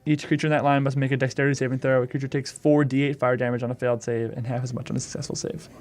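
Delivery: usual speaking voice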